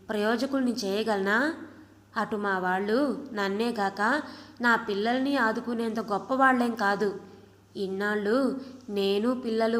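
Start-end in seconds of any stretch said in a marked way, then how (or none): none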